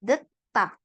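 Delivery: "Deta" said alone, T crisp and clear; model reading. Shown as a demonstration. Teacher modelling the word for the student, crisp "Deta". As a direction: read "Deta" cleanly as the model. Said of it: "langsung dibaca begini aja".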